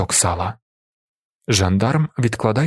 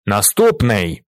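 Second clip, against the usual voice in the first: firm, slightly raised call; repeated order; slower than usual. A narrator's reading. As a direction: call the next one with growing impatience. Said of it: "повторює". (наполегливий, трохи гучніший оклик)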